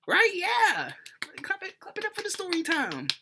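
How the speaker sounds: very high, laughing cheer; rally the crowd to clap; hyped cheer. Acted. Host cheering viewers for earning a story time, very high.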